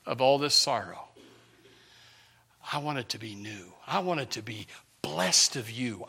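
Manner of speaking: low, soft, earnest confiding in God; hushed pleading prayer. Retold or imitated. Preacher as Jabez asking God to bless him, hushed plea. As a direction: sink to a hush, nearly whispered by the end.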